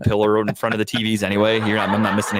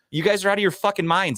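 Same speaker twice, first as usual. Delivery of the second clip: higher-pitched angry tirade; heated rant; 8 semitones higher than usual. Real, not acted. Venting at fans during a podcast tirade, heated. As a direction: let the frustration boil over. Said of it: "Deep breaths, [name]".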